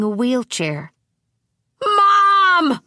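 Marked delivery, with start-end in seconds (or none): 1.8-2.8 put-on much higher, much louder, a desperate boy's scream; screaming for mom. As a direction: scream for your mother once more. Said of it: "Wayne screamed again".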